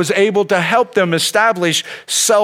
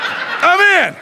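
delighted; 11 semitones higher than usual. Retold or imitated. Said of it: "I was like".

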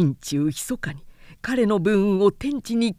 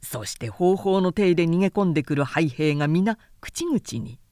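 ordinary delivery, nothing marked

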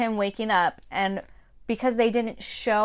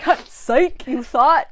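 higher, louder sneering taunt; mocking taunt; 6 semitones higher, 9 dB louder, slower than usual. Gloria taunting her son as she dies, smug louder taunt. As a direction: gloat like a smug brat. Voicing Gloria's spiteful death.

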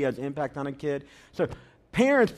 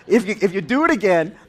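louder threatening father, half laughing; stern dad warning; 3 semitones higher, 8 dB louder than usual. Retold or imitated. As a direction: warn the kid firmly. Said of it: "So he says".

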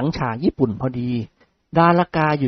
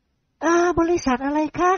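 put-on high, slow, bashful girl voice; shy young woman; 13 semitones higher, much slower than usual. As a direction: ask it shyly in a girl's voice. (เสียงหญิงสาวเขินอาย)

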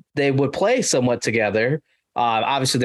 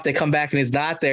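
normal talking voice

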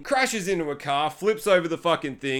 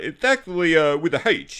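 put-on weird Hubie accent. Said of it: "he talks in this weird accent".

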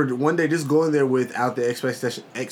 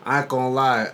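normal talking voice

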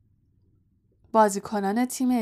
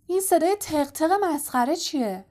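shouted question; 7 semitones higher than usual. A narrator's reading. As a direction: call out across the pitch. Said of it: "فرد فریاد زد".

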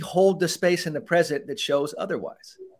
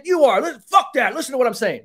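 high, loud mock angry retort; hammed-up angry comeback; arguing back; 8 semitones higher, 4 dB louder than usual. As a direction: snap back like a cop losing his cool. Role-play arguing back angrily.

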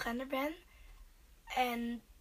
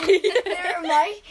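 louder laughing retort; 5 semitones higher, 13 dB louder, much slower than usual. Real, not acted.

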